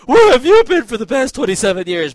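loud, high snarky jab; mock the listener, loud and snide. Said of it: "I could snarkily say".

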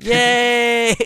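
high, loud mock yay; mock cheer. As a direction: squeal a fake victory cheer. Mock cheer for winning bid.

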